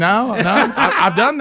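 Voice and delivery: giggling through the denial; laughing. Real, not acted. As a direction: laugh while you protest. Laughs at co-host's joke.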